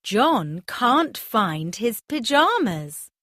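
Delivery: ordinary delivery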